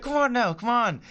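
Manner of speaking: imitated uncle, pitched well up; uncle's accent. Uncle urging nephew to come away, imitated accent. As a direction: coax him in a put-on uncle's voice. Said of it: "Good accent".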